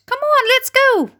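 high, eager urging; rally everyone to set off; chorused. A narrator's reading. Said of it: "they chorused".

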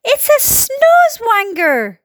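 high, slow, stretched exclamation; police chief's high wail of alarm; alarmed cry. A narrator's reading. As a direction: cry it out in horror, dragging the words. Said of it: "cried the chief of police".

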